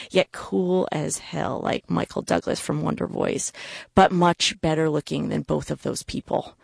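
plain reading voice

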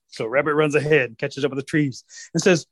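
plain reading voice

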